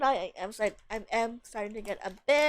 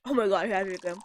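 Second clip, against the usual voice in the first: ordinary talk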